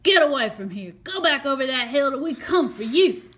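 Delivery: loud urgent warning; cried out. Acted. Scipio warning Dan away from battle, loud urgent call. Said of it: "he cried out".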